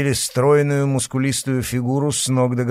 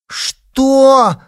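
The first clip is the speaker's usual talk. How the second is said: high outraged yelp; indignant shout; 14 semitones higher, 9 dB louder than usual. A narrator's reading. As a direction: snap it out, outraged. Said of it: "Алёна возмущённо вскочила с дивана". (возмущённый выкрик)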